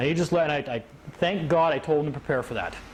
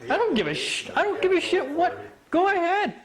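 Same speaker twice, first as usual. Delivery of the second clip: very high, dismissive outburst; hammed-up who-cares challenge; mock defiance; 13 semitones higher than usual. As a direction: scoff at their authority. Voicing brother's dismissal of the judge.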